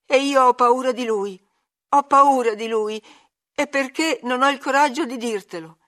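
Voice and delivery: high, loud, frightened confession; acted terror, pleading; panicked gasping. A narrator's reading. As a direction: blurt out the fear in anguish. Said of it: "ansimò il ragazzo".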